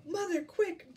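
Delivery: high, loud call for help; urgent shout. A narrator's reading. Jack calls his mother for help, urgent shout. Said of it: "he shouted".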